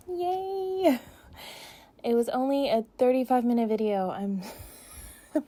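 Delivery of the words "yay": a bright, high little cheer; cheerful yay. Real, not acted. Cheering to viewers at the end of the Q&A, excited. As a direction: celebrate being done. Celebrating finishing all the questions.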